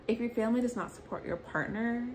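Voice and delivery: ordinary delivery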